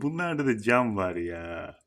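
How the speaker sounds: ordinary talk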